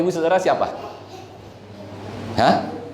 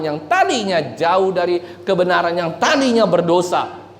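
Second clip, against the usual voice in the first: raised, driving sermon voice; fervent preaching; 8 semitones higher than usual. Acted. Preacher urging the church in full fervour. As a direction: preach it hard to the congregation.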